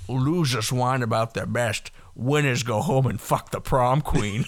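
slow, lightly voiced Sean Connery drawl; a hint of Sean Connery, slow and deliberate; Sean Connery impression. Sean Connery quoted to the hosts, a light slow Sean Connery voice. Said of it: "Sean Connery's like".

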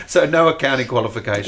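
asked through a real laugh; laughing while teasing the point; amused, laughing question. Real, not acted. Interviewer amused by no qualifications.

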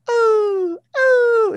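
high, loud drawn-out howl; fan howl. Retold or imitated. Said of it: "howling during the game".

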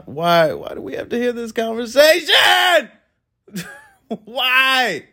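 high, half-laughing cry of disbelief; exasperated outcry. Retold or imitated. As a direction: throw up your hands at the noise. Loud woman in waiting room.

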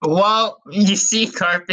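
normal talking voice

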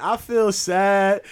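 put-on high, very slow little-girl reply. Neffy answers her teacher in front of the class, high and slow. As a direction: drag it out like a sulky four-year-old. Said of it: "Neffy like".

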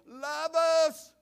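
high called-out name; hollering a name. Retold or imitated. Son-in-law calling through the patio door, high holler. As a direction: call her name through the cracked door. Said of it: "And he hollered".

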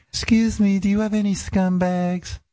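put-on high girly falsetto voice; girl voice. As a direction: kid faking a girl on the phone. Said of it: "I'd be doing a girl voice".

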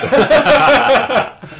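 high loud cackle of laughter; loud burst of laughter. Real, not acted. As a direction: crack up loudly.

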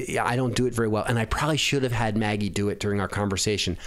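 ordinary delivery

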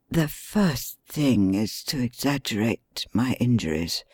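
hushed bedridden plotting; weak injured man. Acted. Injured Holmes plots from bed.

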